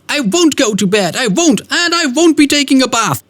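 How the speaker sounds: put-on high, excited little-boy voice; a stubborn kid refusing bedtime; little Michael. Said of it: "little Michael racing onto the stage saying".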